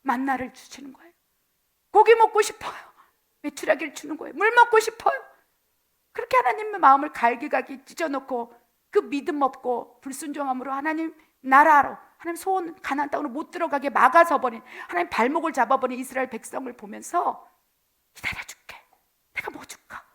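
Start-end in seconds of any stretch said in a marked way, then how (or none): 1.9-5.2 whiny pleas, pitched up and louder; begging. Acted. Israelites demanding meat and water, whiny begging. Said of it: "불평하고 불만하는 거예요". 18.2-20.0 soft whisper, barely voiced. God quietly offering his people anything, whispered.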